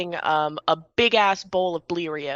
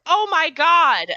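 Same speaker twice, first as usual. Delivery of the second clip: exclaiming sister; 10 semitones higher, 7 dB louder, slower than usual. Acted. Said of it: "the occasional, like".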